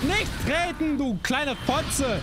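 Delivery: high angry shout at the boss; furious yell. Real, not acted. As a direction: scream it in frustration. Raging at boss in game.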